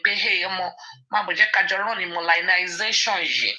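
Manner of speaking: ordinary delivery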